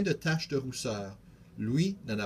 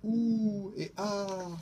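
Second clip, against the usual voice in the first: much higher oohing; amazed ooh and ah; 7 semitones higher, much slower than usual. A narrator's reading. Louis delighted by the beetle, high ooh and ah. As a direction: coo in delight. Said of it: "Louis fait".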